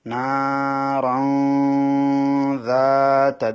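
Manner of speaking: নারান যাতা chanted slowly, tanwin held in the nose; slow tajweed chant; ikhfa nasal hold. Shown as a demonstration. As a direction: draw out নারান then hide the n before যাতা. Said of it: "তানবিনটাকে গুণনা করতে হবে".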